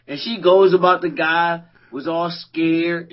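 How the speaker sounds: ordinary talk, nothing marked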